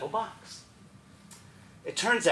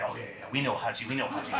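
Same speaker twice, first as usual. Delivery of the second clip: low, quick, softer overlapping assent; very quick agreement; 6 semitones lower, 7 dB quieter, much faster than usual. Retold or imitated. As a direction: rush it out under your breath. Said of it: "all the teachers very quickly".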